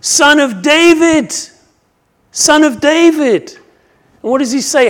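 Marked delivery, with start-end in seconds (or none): 0.0-3.5 loud, high cry for attention; preacher acting a desperate shout. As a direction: call out urgently, stretching the name. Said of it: "he cried out and he said".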